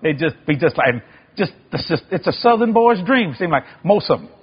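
usual speaking voice

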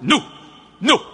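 sharp loud refusal, imitated; hard and abrupt. Guard stops a tourist walking to the wall, hard and abrupt. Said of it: "That's how they talk, real kind of hard".

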